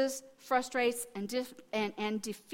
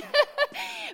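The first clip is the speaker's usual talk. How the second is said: high, loud burst of laughter; amused laugh; 9 dB louder than usual. Real, not acted. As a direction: crack up at yourself. Laughs at her own detour.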